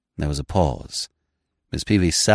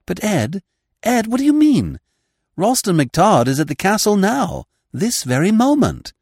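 high, eager woman's voice; raised pitch young woman; Liz's voice; 13 semitones higher, 4 dB louder than usual. Acted.

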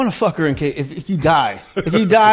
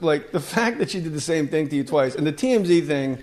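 normal talking voice

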